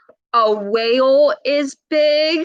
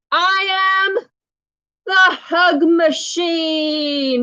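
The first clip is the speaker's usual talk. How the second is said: dramatic hug machine; 5 semitones higher, much slower than usual. A narrator's reading. Dramatic reading of title line.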